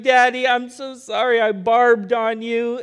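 lightly voiced sorry kid, words drawn out; imitating crying child. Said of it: "and he's crying".

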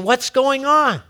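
"What's going on" high and falling at the end; puzzled onlooker. Acted. Acted onlookers question the change.

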